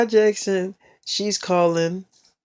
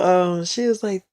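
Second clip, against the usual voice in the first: normal talking voice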